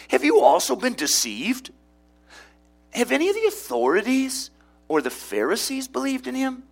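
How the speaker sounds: high, sneering outrage at the officers; scornful Pharisees. Acted. Pharisees scoffing at officers for being deceived.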